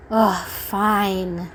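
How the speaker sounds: imitated reluctant groan of a yes; grudging agreement. Imagining their reply when asked to do things, grudging. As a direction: give in grudgingly, with an audible huff. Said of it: "it almost feels like an".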